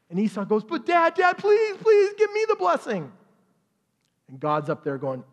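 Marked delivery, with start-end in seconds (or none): 0.6-3.1 high, loud, begging cry; desperate pleading. Retold or imitated. Esau begging his father for the blessing, high pleading shout. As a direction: plead like a son who lost everything, up high and loud.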